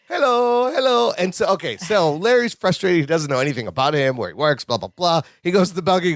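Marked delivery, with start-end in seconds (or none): 0.1-1.1 higher mock Balky hello; imitating Balky. Retelling the sitcom, cohost mimics Balky's hello, higher. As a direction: chirp it up high like Balky. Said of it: "like, how many times have we done it".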